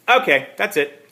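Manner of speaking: fed up. Acted. Detective losing patience.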